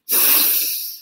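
a long, audible release of breath through the mouth; guiding the group into a first breath, a slow exhale; deep demo exhale. Shown as a demonstration. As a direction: breathe out slowly and let it all go. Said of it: "Come on, breathe with me".

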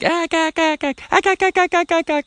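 loud, high, rapid duck calls; duck call honks. Shown as a demonstration. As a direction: hit them with everything, building excitement. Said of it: "hit 'em with everything".

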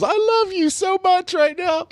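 squeezed out laughing. Retold or imitated. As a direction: force the words out through helpless laughter. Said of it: "all I can squeeze out was".

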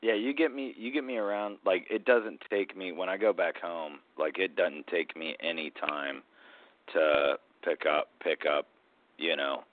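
ordinary talk, nothing marked

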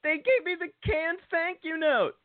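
high, incredulous complaint; outraged at a form letter; indignant disbelief. Real, not acted. Outraged at a canned note.

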